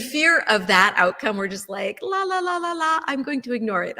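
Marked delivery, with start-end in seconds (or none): 2.0-4.0 high singsong la-la; childlike refusal to hear, pitched way up; mock ignoring. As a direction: block it out with playful chanting. Acts out ignoring an unwelcome outcome.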